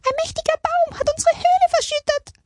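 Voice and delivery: fast, squeaky-high mouse lament; whining mice. A narrator's reading. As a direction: gabble the complaint as a tiny mouse. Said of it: "jammerte ein Mäusepaar". (jammernde, schnelle Mäusestimme)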